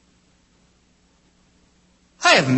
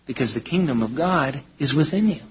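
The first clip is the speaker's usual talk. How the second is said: ordinary talk, nothing marked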